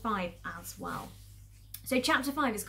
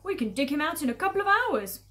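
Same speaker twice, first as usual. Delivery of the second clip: pleased farmer; 5 semitones higher, 6 dB louder than usual. Acted. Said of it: "said Boggis".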